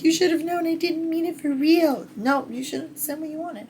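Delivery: high, put-on voice arguing with the heroine; high mock voice. Reviewer talking back to the heroine, high and mocking. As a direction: voice the petty retort in a squeaky mock tone. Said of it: "I was like".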